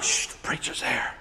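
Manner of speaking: acted whisper behind a door; hushed warning. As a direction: shush them, very soft and secretive. People inside hiding from preacher.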